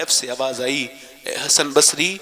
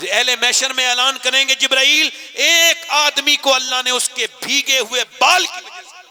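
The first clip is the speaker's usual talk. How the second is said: high, loud fervent preaching; preaching climax; 10 semitones higher, 4 dB louder than usual. Real, not acted. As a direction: lift the crowd at the peak. (پرجوش بلند خطابت)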